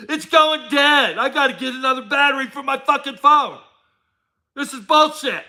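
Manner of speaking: high, fed-up mock rant about a dying phone; mock phone owner. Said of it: "they're act like".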